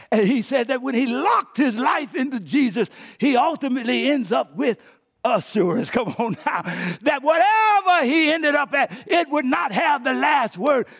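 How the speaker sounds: high, loud, driving sermon delivery; impassioned preaching. Real, not acted.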